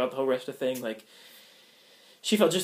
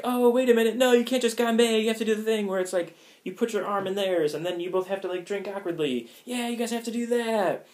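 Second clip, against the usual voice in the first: excited hosts; 7 semitones higher than usual. Retold or imitated. Party hosts telling the couple to link arms, high and eager. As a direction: mimic the pushy party hosts.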